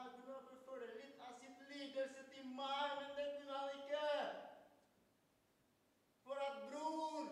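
pleading outcry for a suffering brother; anguished lament. Acted. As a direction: build from slow pain to a loud wail.

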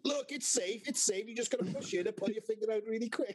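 put-on high, bouncy Dutch accent. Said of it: "I'm gonna butcher the Dutch accent now".

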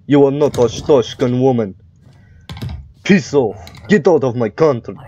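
hammy mock Russian accent; terrible Russian accent. Inspector sending a woman out of the country, terrible Russian accent. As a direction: play a surly guard with a fake Russian accent. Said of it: "I'm terrible at doing accents".

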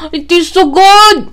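slow, high, loud gasp of delight. Real, not acted. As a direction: gasp then savour it slowly. Gasp on tasting the cake.

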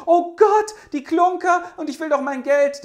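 very high, loud panicked shriek. Acted.

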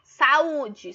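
"Saúde" with A and U pulled apart; loud "Saúde", giving the U its own beat; separated vowels. Shown as a demonstration. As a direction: split "Saúde" into sa-ú-de, both vowels strong.

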